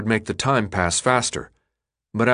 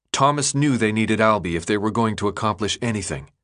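usual speaking voice

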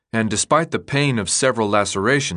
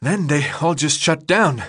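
slightly higher, lightly voiced battered fighter; Minho's voice; 5 semitones higher than usual. A narrator's reading. Minho tells Thomas how many died, voiced lightly and grim. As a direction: report the losses grim and winded.

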